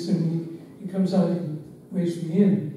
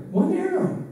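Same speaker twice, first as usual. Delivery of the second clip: "one arrow" pitched way up for emphasis; emphatic stress; 14 semitones higher, 3 dB louder than usual. Real, not acted. Telling how the boy came out armed, high emphatic "one arrow".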